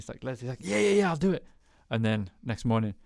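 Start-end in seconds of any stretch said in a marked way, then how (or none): 0.6-1.4 eager Leslie. Retold or imitated.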